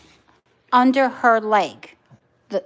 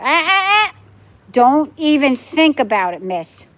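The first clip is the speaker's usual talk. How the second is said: sharp, louder "ah ah" warning; quick high warning, then firm telling-off; scolding a pet; 3 semitones higher, 4 dB louder than usual. Real, not acted. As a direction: stop the pet in its tracks.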